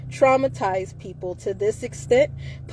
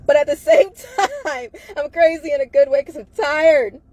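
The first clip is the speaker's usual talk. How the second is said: laughing; 5 semitones higher, 6 dB louder than usual. Real, not acted. Laughing about being crazy.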